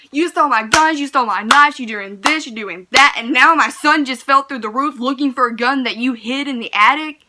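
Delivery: loud, high, furious retold rant at the thief; dad's angry yelling. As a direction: let the father's rage boil over. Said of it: "He's talking about some".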